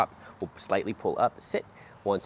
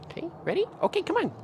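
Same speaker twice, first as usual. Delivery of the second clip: much higher, bright go cue; high dog cue; faster than usual. Real, not acted. Trainer cueing puppy to walk, high and bright. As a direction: lift the voice to get him moving.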